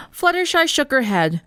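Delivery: plain reading voice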